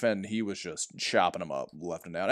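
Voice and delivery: ordinary talk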